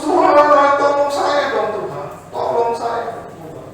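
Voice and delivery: high, loud begging cry; high pleading. Acted. Climber begging God.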